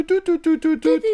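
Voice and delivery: high beeping dial tones; dialing beeps. Retold or imitated. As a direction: beep out each digit. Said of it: "tu viens de composer".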